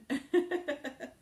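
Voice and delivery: high burst of giggling; amused laugh. Real, not acted. Host laughs at viewers complaining about the food, amused. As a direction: laugh out loud in short bursts.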